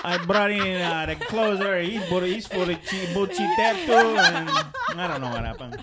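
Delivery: put-on a ranting Ozzie Guillen accent cracking up. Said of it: "terrible accent".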